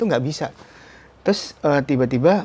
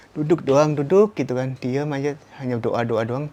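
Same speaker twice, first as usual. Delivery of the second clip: ordinary talk, nothing marked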